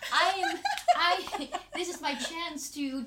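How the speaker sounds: high, slow laughing words. Retold or imitated. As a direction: laugh through the excitement. Laughs at her own eagerness.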